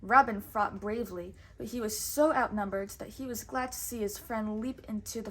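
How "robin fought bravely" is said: plain reading voice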